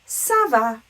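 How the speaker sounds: rising French question "ça va"; question intonation. Shown as a demonstration. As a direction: ask "ça va" with a clear rise. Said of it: "raise our voice tells us that that is a question".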